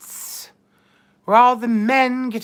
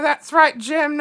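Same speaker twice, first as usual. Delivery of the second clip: ordinary delivery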